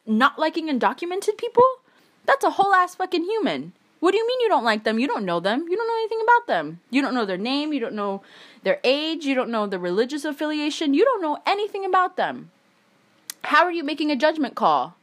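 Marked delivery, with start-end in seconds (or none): none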